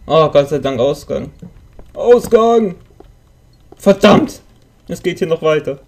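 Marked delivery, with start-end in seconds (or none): 1.9-2.8 loud relieved cry; excited shout. Real, not acted. Spots a way out. 3.8-4.4 frustrated curse. Real, not acted. The way out is not one.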